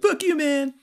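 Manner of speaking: put-on high-pitched teenage backtalk; mock sixteen-year-old. Sixteen-year-old answering his stepdad, high mock defiance. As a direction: imitate a teenager, voice way up. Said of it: "when you're, like, sixteen".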